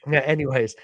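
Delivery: usual speaking voice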